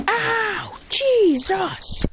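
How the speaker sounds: high yelp of pain; mock pained cry; pained yell. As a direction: cry out flying through the air. Punched through the roof.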